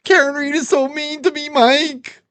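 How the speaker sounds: high whining crybaby voice; whiny imitation. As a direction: mimic him whimpering and complaining. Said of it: "you were crying about Karen Reed".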